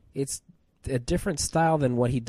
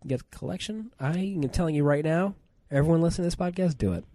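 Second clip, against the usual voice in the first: emphatic pitch; 6 semitones higher, faster than usual. Real, not acted. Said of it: "urging listeners".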